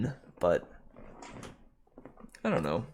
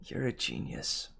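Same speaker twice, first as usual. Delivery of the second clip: soft, breath-only whisper; Percy whispering; 7 dB quieter than usual. Retold or imitated. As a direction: whisper it as a sleepy, private compliment. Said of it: "Percy whispering".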